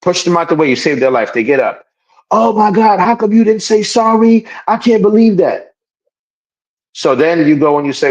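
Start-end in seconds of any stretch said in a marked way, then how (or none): 2.3-5.8 high-pitched, aggrieved disbelief; put-on shocked complaint, pitched up; mock indignation. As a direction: whine it like an offended victim. Acted outrage of the rescued person.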